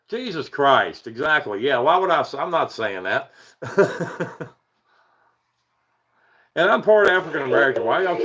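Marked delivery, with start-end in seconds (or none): 3.6-4.5 high short chuckle; real laugh. Real, not acted. Streamer refusing to read chat's bait, chuckling. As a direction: chuckle in quick pulses.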